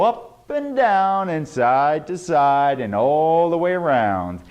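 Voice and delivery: drawn-out, singsong delivery; rolling speech pattern. Shown as a demonstration. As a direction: stretch and swoop through the words. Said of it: "your speech patterns will go".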